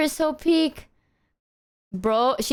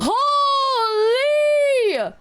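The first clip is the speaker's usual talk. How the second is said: high, loud, stretched surprised shout; 11 semitones higher, 4 dB louder than usual. Real, not acted. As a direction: shriek out in shock. Reacts to a big donation.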